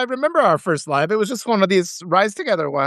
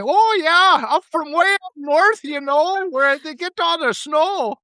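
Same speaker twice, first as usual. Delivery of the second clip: put-on high, drawn-out Fargo Minnesota accent; hammed-up northern accent from the movie Fargo; 7 semitones higher, slower than usual. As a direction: exaggerate a sing-song Fargo accent, pitched up. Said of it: "That's like Fargo, the movie Fargo".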